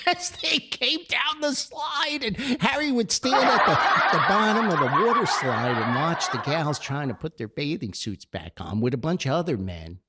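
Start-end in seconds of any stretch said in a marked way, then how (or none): 0.1-8.2 words broken up by real laughter; can barely get it out for laughing; laughing while telling. Real, not acted. Telling a funny story, laughing.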